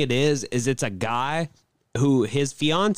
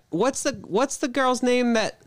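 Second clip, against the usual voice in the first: higher-pitched retold coaxing question; prompting husband; 7 semitones higher than usual. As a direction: quote a man fishing for an answer. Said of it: "he's like".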